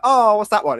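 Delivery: high, loud mock-mum exclamation; put-on inquisitive mum; curious mum. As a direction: imitate a mother spotting something, pitched right up. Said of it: "And she'll be like".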